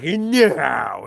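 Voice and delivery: a lightly voiced drawn-out no; exaggerated no. Imitating Luke's exaggerated drawn-out no. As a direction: ham up a slow stretched no. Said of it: "it's all exaggerated and shit".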